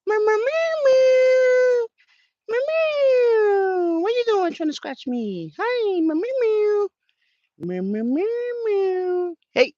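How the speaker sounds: very high squeaky meows; meowing at cat. Real, not acted. Meowing to his cat, very high playful mock meows. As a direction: meow back at the kitty playfully.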